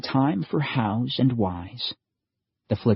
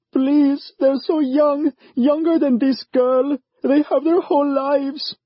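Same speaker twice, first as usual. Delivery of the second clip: much higher, louder begging mother; calling out; 5 dB louder than usual. A narrator's reading. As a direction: call out to save her sons. Mother begs for boys.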